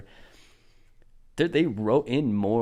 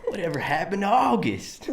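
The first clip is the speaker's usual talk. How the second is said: bad Southern cowboy accent, pitched up; awful cowboy accent; 7 semitones higher than usual. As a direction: ham a clumsy Southern twang. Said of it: "Fucking awful".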